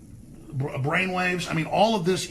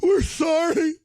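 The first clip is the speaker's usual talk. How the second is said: high, whiny, pleading mock voice; mocking parody, pitched high and loud; mock groveling liberal women; 11 semitones higher, 3 dB louder, slower than usual. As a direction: grovel and whimper like apologetic activists. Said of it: "We're all like".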